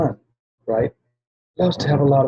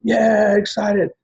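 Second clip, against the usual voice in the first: excited cheer; 9 semitones higher, much slower than usual. Shown as a demonstration. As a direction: whoop it up from the back row. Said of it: "but you're like".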